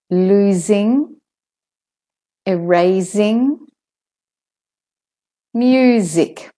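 "Losing, erasing, music" with voiced z; slow word-list model, clear z in "Losing, erasing, music". Shown as a demonstration. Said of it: "where the S says Z".

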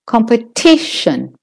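"competition" with main stress on TI. Shown as a demonstration. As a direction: lean on the third syllable of "competition". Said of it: "or long as the T".

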